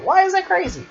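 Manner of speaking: high-pitched, incredulous squeal of a question. Acted. Fan questions a character's marriage plan, incredulous. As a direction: voice leaps way up in disbelief. Mocking the crazy marriage idea.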